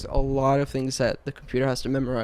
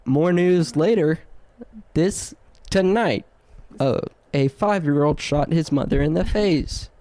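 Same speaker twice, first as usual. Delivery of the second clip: news anchor voice; 2 semitones higher than usual. Pretend. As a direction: sign off like a TV anchor. Mock news anchor outro.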